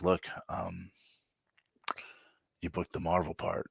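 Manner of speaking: soft, calm delivery of big news; hushed understated announcement; quiet news. Retold or imitated. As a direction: let it drop gently. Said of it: "And he's like".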